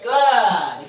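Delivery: loud, stretched-out praise word; big approving praise; hearty praise. Real, not acted. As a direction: reward the dog with your voice. Praising the dog.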